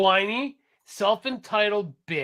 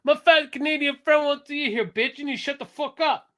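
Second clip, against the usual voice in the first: higher New York tough talk; higher mock New York accent; mock wiseguy; 7 semitones higher than usual. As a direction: play a Jersey thug. Mobster bit continues.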